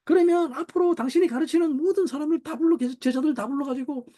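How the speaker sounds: high-pitched, insistent wifely demand; imitated ranting wife. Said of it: "이렇게 막 이야기해요". (몰아붙이는 아내 흉내, 높은 소리로)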